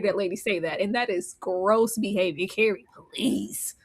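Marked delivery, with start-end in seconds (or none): none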